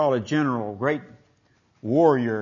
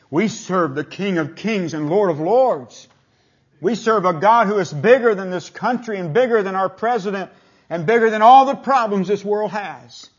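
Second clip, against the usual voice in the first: louder, higher sermon build, calling it out to the congregation; full-force preaching; 7 semitones higher, 10 dB louder than usual. Acted. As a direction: swell into big preacher mode on each "we serve".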